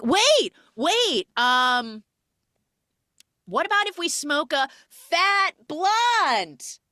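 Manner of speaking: high, loud, slow shouted offer; screaming. Retold or imitated. She screams to keep Channing from leaving, high and loud. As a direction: yell it to stop him leaving. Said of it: "And screams".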